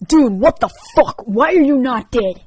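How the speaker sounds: high, loud angry shout; angry outburst. Acted. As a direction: yell it in his face. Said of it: "unscatable anger".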